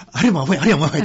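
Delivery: hammed-up accusing call; mock shout. Monk mimicking people who blame others: mock loud accusation. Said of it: "って、して言うたらダメ".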